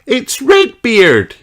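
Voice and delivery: slow, high, loud cry of alarm. Acted. Ben spots the pirate's boat and cries out. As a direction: cry out the name in a fright. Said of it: "he cries".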